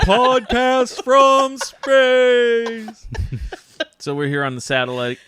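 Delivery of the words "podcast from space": drawn-out announcer voice. Pretend. Joking about the show's name.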